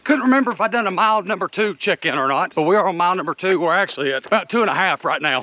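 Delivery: talking mid-jog, breath with each stride; while running. Real, not acted. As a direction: chat between strides on a morning run. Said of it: "on a run here".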